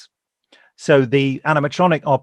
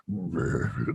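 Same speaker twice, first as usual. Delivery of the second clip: low creature grunt; 9 semitones lower, 9 dB quieter than usual. Acted. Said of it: "making noises that would sort of fit with it".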